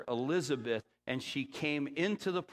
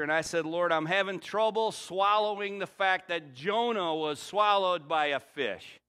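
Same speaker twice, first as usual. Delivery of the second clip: louder, higher storytelling from the pulpit; raised preaching voice; 4 semitones higher, 5 dB louder than usual. Acted. Pastor retelling his prayer, raised preaching. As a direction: tell it out big to the room.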